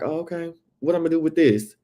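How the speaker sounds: high, hesitant imitation of puzzlement; unimpressed reaction. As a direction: act out an underwhelmed gift recipient. Acting Garcelle's reaction to gift.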